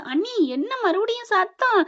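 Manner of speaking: high, slow, drawn-out question; acted high-voiced sister-in-law asking; calling inquiry. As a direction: call through the door, pitched well up. Sister-in-law calls from outside.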